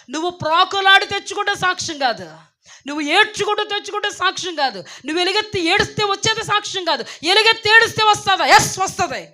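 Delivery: high, loud rebuking sermon; impassioned loud preaching; full-force preaching. Real, not acted. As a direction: hammer each line at the congregation. Preacher rebukes crying for things.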